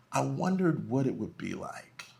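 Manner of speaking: ordinary delivery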